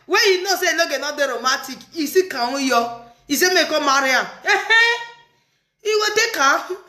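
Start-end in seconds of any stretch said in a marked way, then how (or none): 4.4-6.8 high excited exclamation. Real, not acted.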